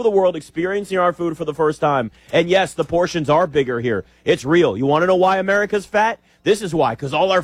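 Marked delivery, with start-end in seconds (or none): none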